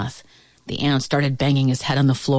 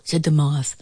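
usual speaking voice

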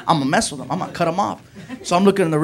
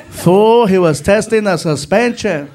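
slow, drawn-out backseat buddy; Felipe's voice; 4 dB louder, slower than usual. Retold or imitated. Friend in back seat answering the cop, slow. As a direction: offer it slowly as the helpful friend. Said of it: "from the back seat".

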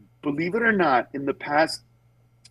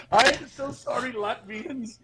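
laughing apology; 6 semitones higher, 3 dB quieter than usual. Real, not acted.

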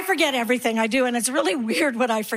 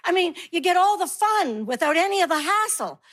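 gushing friends; 6 semitones higher than usual. Acted. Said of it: "And they're like".

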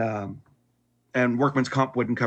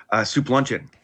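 usual speaking voice

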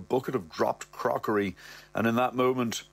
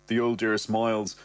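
ordinary talk

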